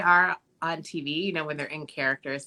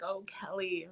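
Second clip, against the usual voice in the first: retold tender murmur; 2 semitones higher, 12 dB quieter than usual. Retold dad seeing his daughter.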